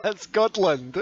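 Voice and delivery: high mock of Scotland through laughter. Teasing the American way of saying Scotland, high. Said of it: "It said Scotland with a D".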